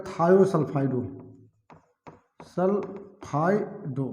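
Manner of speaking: सल्फाइडो soft and slow, syllables separated; drawn-out term. Shown as a demonstration. Teacher dictating the word's ending to students, slowly drawn out. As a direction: break सल्फाइडो into slow, quiet parts.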